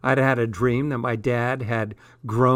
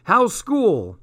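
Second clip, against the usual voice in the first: high-pitched eager dad; enthusiastic and keen; 8 semitones higher, 3 dB louder than usual. A narrator's reading. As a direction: ask it keenly, pitch jumping up then falling. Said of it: "He was all enthusiastic and keen".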